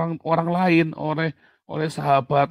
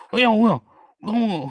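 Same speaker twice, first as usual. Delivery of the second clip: mocking child voice; 7 semitones higher, 7 dB louder than usual.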